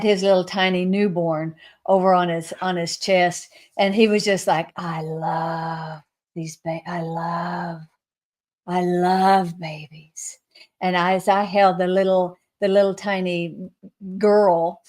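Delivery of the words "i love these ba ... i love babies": slow, soft and loving; tender adoration. Retold or imitated. Jesus cradling a newborn, softly adoring. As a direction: linger on each word like gazing at a baby.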